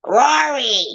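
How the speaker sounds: very high mimicked calling of a name; Sally Struthers. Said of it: "Fucking Sally Struthers".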